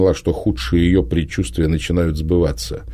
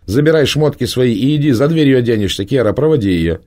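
higher, louder impatient commands; impatient orders; 7 semitones higher, 5 dB louder than usual. A narrator's reading. Prima orders the girl out and Kera to escort her, loud. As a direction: hurry her out the door.

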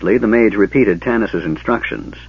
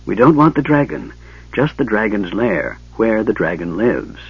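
ordinary delivery, nothing marked

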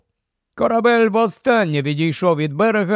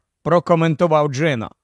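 ordinary delivery, nothing marked

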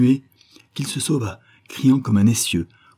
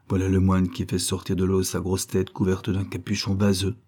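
ordinary delivery, nothing marked

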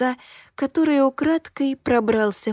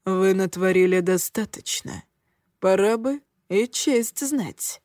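cold low voice. A narrator's reading. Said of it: "низким, ледяным голосом".

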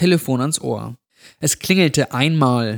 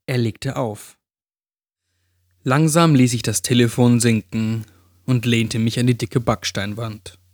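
usual speaking voice

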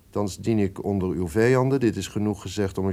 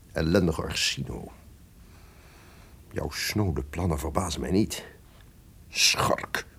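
whispered curse; 3 semitones lower, 6 dB quieter than usual. Acted. Villain cursing traitor alone.

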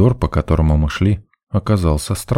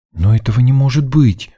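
put-on higher-pitched girlish disbelief; stubborn protest; 6 semitones higher than usual. A narrator's reading. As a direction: refuse to accept it, voice raised in pitch. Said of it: "не сдавалась исследовательница". (упрямо возражать высоким голосом)

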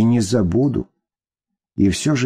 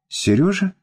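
Серёжа? jumps high in astonishment; surprised gasp; 10 semitones higher than usual. Acted. Мама, увидев бывшего зятя на пороге, высоко и изумлённо. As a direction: caught off guard, voice leaps up. Mother sees ex-husband at door.

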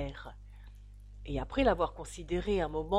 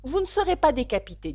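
raised, louder voice; firm promise; 7 semitones higher, 7 dB louder than usual. A narrator's reading. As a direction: declare it loudly and firmly. Alice firmly promises protection. (promesse ferme, voix haute)

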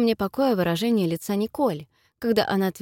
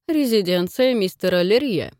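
rumbling voice; 3 dB louder than usual. A narrator's reading. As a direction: rumble it out like far-off thunder. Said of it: "её голос был похож на отдалённые раскаты грома".

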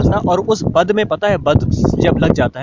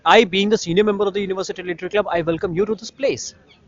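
mock formal welcome; 4 semitones higher, much slower than usual.

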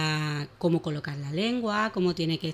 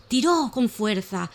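put-on lively, animated reading of a children's tale; expressive children's story voice; storytelling for children; 2 semitones higher, 3 dB louder than usual. A narrator's reading. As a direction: tell it like a bedtime story for little ones.

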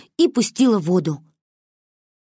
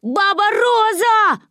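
long drawn-out high holler; high, stretched cry for help; yelling; 13 semitones higher, 4 dB louder, much slower than usual. A narrator's reading. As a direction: call out loud and slow in alarm, like a child. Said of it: "орала я".

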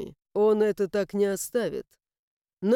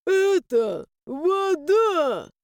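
high, slow wailing ghost; tragic moan; 7 semitones higher, much slower than usual. A narrator's reading. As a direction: moan it out in mock tragedy. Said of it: "трагично простонал призрак".

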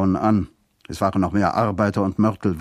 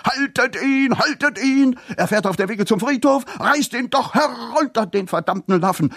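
viel höher, wütend hinterhergerufen; tobendes Schreien; 12 semitones higher than usual. A narrator's reading. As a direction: schrei dem Fahrzeug wütend nach. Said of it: "Piepsam tobte noch immer". (wütend und schrill hinterhergeschrien)

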